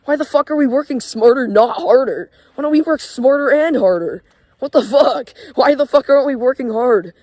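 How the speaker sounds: vent, fed up; angry rant. Real, not acted. Ranting at people who say work smarter, angry and loud.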